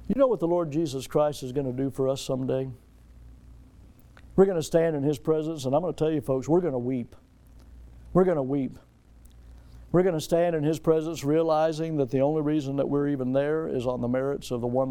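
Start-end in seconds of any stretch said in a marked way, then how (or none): none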